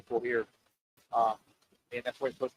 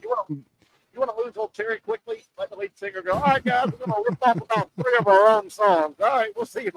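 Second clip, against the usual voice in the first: mock rock singer; 6 dB louder than usual. Mock rock singer quitting band.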